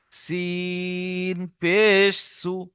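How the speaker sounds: very slow, long-held সিন and পেশ; drawn-out spelling, pauses between parts; chopped-up spelling. Shown as a demonstration. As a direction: spell it out piece by piece. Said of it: "বানান করার সময় কেটে কেটে".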